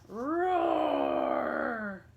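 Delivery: big loud roar; lion roar. Acted. Lion jumping out to scare the old woman, roaring. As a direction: leap out and roar.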